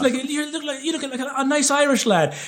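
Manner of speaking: high, lightly voiced Irish local; imitating Irish woman. Irish woman praising the visitor, high cheerful voice. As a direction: chirp it like a friendly local lady. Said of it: "one of them was like".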